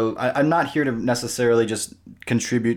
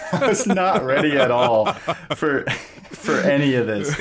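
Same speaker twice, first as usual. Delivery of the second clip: laughing; 4 semitones higher than usual. Real, not acted. Laughing at the intro.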